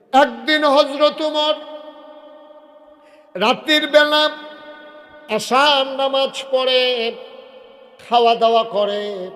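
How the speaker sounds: high, loud, drawn-out proclamation; slow high-pitched preaching shout; full-force preaching. Acted. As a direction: call each phrase out from the pulpit.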